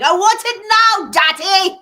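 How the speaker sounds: put-on high, loud, bratty child's whine; spoiled Willy Wonka girl. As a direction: demand it like a spoiled brat stamping her foot. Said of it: "the Willy Wonka chocolate factory girl".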